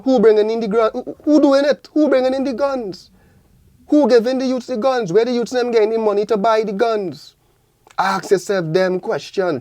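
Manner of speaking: high-pitched, indignant rhetorical questions; heated, accusing questions fired out high; impassioned challenge. Real, not acted. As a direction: demand answers, voice pitched right up. Impassioned challenge over guns reaching youths.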